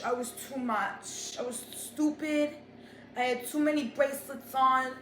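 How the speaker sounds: ordinary talk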